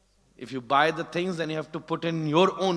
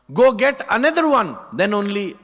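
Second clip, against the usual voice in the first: loud, high shout of refusal; angry shout; 6 semitones higher, 9 dB louder than usual. Retold or imitated. Nanda Baba refusing the devotee, loud angry shout. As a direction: shout him off to fetch another.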